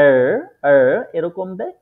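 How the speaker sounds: এর said with a gargled throat R; German R as if gargling water; guttural German R. Shown as a demonstration. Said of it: "গলার ভেতরে পানি নিয়ে আমরা যে একটা আওয়াজ করি".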